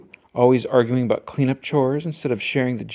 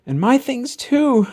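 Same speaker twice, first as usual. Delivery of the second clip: higher slow protest, lightly voiced; Brother complaining; 9 semitones higher, 4 dB louder, slower than usual. A narrator's reading. Brother adding his complaint to Papa, aggrieved. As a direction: join in, aggrieved. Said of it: "cried Brother".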